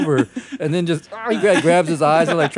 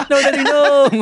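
high, loud mock kid's plea while laughing; pleading child; 11 semitones higher, 7 dB louder than usual. Kid begging daddy to stop, high and laughing. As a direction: whimper like a scared kid, hammed-up.

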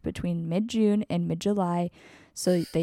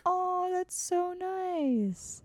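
charmed; 11 semitones higher, 6 dB quieter, much slower than usual. Real, not acted. Charmed by bison month.

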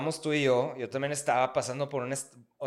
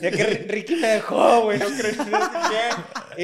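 laughing mock voice; 6 semitones higher, 7 dB louder than usual.

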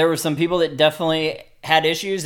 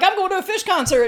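mock naive voice. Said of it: "I'm like".

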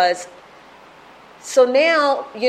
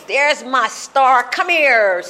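loud, higher call of delight; doting drunken father; 4 semitones higher, 4 dB louder, slower than usual. Retold or imitated. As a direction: imitate dad proudly hailing his star. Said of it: "he would wake me up and he goes".

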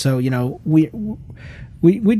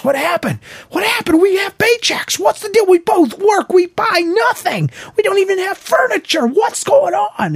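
much higher, loud frantic yelling; mock panic; 3 dB louder than usual. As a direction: act out panicking about money. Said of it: "And I'm like".

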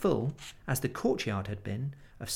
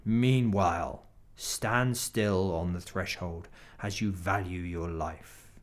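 menacing wolf; 4 semitones lower than usual. Acted. Wolf warns Edmund.

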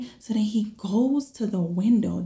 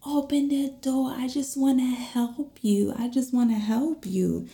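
higher-pitched imitation of a pushy stranger; retold insistent plea, pitched up; insistent intruder; 6 semitones higher than usual. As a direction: mimic him pleading through the door. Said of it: "He's banging, he's like".

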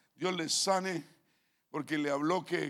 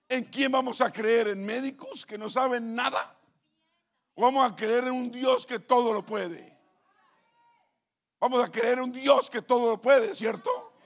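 louder rallying questions, ending in a high shouted ¿cierto?; preaching at full force; 5 semitones higher, 9 dB louder than usual. Acted. Pastor to the congregation, rousing call to faith. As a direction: rouse the crowd with each question.

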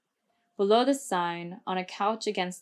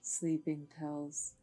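soft and slow; barely above a murmur; quiet voice; 4 semitones lower, 11 dB quieter, slower than usual. A narrator's reading. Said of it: "added Adam in a quiet voice".

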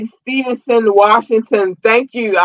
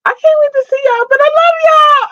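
high, loud gushing shout; excited squeal; 6 dB louder than usual. Real, not acted. Streamer gushing to viewers she can't wait to see, excited. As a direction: burst out with joy.

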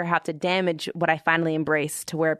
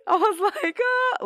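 laughing through tears. Real, not acted. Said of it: "I actually cried on the couch".

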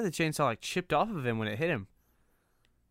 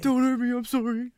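high, loud whimpering plea; begging for my life; 12 semitones higher, 8 dB louder than usual. Retold or imitated. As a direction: beg like a scared child. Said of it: "beg for his life".

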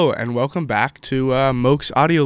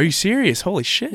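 surprised; 8 semitones higher, 4 dB louder than usual. Real, not acted. Host shocked by sixty-nine books.

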